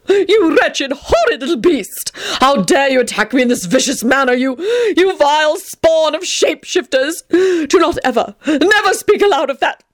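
high, indignant dramatic scolding; outraged tirade. Acted. Tony scolding Teddy for biting him, high and indignant. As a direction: fling insults like an offended lord.

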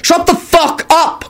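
high, slow, emphatic yell; shouted. A narrator's reading. Quentin silencing the breakfast uproar, shouted. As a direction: shout down the room. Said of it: "Quentin shouted".

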